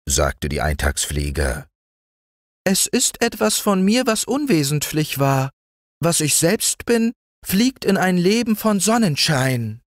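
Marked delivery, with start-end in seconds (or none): 2.6-9.8 very high, light mayfly voice; dreamy mayfly. A narrator's reading. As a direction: float it out like a mayfly in the sun. Mayfly describing her life.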